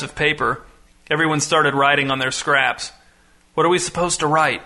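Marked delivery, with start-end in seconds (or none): none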